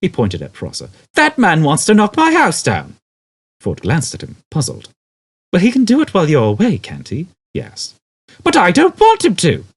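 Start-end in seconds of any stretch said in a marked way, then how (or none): 1.1-3.0 loud high indignant shout. A narrator's reading. Arthur shouting to Ford about Prosser's plan, indignant. 5.5-7.3 quick, high, offhand; puzzled. A narrator's reading. Puzzled Ford suggesting Arthur leave the house, quick. 8.4-9.7 loud high protest. A narrator's reading. Arthur protesting to Ford about his house, loud.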